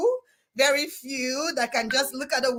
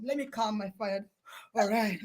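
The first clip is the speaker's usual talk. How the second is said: much softer and lower, settling herself; calmed down; 6 semitones lower, 9 dB quieter than usual. Shown as a demonstration. Host cooling her own excitement, soft and low. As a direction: bring the energy right down.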